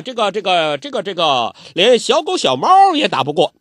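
put-on old urchin booming a silly oath; Zhou Botong's oath. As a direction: declaim a comic sworn oath like a grand ritual. Loudly swearing an oath.

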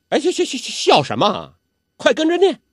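high, loud impatient shooing; cranky old urchin telling him to recite; Zhou Botong scolding. Acted. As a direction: wave off his laughter and bark him onward. Zhou Botong glares at Guo Jing.

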